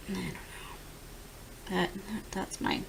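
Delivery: soft, slow whisper fading out; trailing off. Real, not acted. Ending her story to viewers, fading to a whisper. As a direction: let it fade to nothing.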